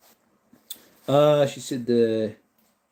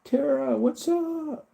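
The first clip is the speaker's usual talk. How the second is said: squeaky-high friendly hello; high greeting; 12 semitones higher, much slower than usual. Real, not acted. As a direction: pop the voice way up to greet her. Greeting a viewer in chat.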